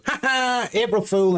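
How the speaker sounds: high, mocking imitation of a prankster; gleeful prank taunt. Imitating the partner springing an April Fool, high gleeful taunt. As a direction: crow it high, imitating her triumph. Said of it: "And then she goes".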